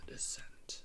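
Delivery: breathy spooky whisper. Acted. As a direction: whisper the title like a horror trailer. Whispering movie title.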